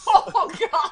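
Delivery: loud burst of laughter; laughing loudly. Real, not acted. Laughing at friend's news reading.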